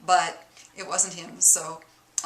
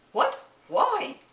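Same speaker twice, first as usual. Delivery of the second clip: quick puzzled husband, higher, mock accent; put-on Middle Eastern accent; 9 semitones higher than usual. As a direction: snap back in a bad Mid-Eastern accent. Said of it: "excuse the accent".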